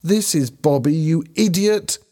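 high-pitched exasperated insult; shouting. A narrator's reading. Helen shouts at Ben for his mistake, shouting. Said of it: "Helen shouted".

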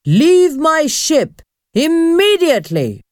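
high, commanding ghost; loud command. A narrator's reading. Male ghost orders children off his ship, loud command. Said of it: "asked in a loud voice".

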